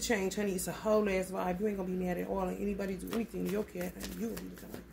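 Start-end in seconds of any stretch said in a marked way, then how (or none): none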